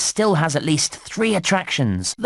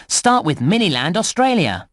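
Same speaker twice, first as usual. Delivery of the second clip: ordinary talk, nothing marked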